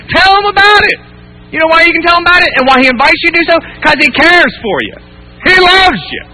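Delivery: high, urgent exhortation; preacher at full force, pitch climbing; impassioned preaching. Real, not acted. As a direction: build to a high, driving plea.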